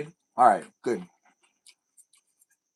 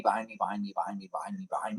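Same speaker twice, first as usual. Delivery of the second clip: be your own echo trailing away; mimicked fading echo; 5 semitones lower, 9 dB quieter, faster than usual. Streamer imitates delayed screens behind them, fading echo. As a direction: repeat it lower and softer each time.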